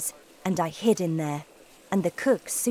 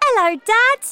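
very high, loud squeaky greeting; Bruno's mouse voice; 11 dB louder than usual. A narrator's reading. Mouse Bruno greets his father.

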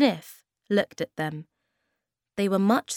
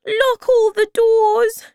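high, loud, drawn-out squeal; frightened tiny creature; 12 dB louder, slower than usual. A narrator's reading. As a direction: squeal an urgent order. Frightened Bob urges locking up.

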